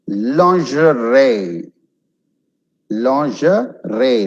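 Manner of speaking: "Lingerie" slowly, ending like ray. Shown as a demonstration. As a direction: draw out "Lingerie", last syllable ray. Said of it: "like an R A Y".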